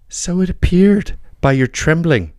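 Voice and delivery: lightly voiced young lady, drawn out. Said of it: "said the young lady".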